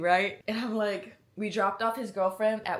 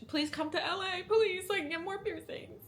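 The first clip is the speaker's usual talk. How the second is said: high, begging whine; playful pleading; 11 semitones higher than usual. Pretend. Asking piercing shop to come to L A, high playful plea. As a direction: beg sweetly, pitched way up.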